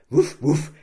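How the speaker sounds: loud, perky woof woof; dog barking cheekily while pulling; cheeky barking. Acted. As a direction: bark saucily like a proud dog. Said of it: "kam's keck aus seinem Mund".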